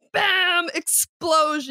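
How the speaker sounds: and then bam, shouted high and slow; dramatic bam. Retold or imitated. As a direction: hit it like a sound effect. Narrating the second explosion.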